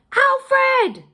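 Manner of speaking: high, loud shout over the shoulder. A narrator's reading. Queen shouting back to her son while dragged off. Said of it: "she shouted over her shoulder".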